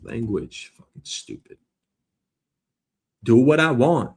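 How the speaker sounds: ordinary talk, nothing marked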